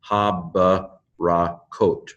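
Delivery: slow "Habarakot" with a gap after each syllable. Shown as a demonstration. Teacher explaining a doubled letter, syllable by syllable. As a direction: close the first syllable on b, then restart. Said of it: "first bait closes the first syllable".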